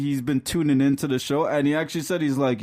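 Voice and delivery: ordinary talk, nothing marked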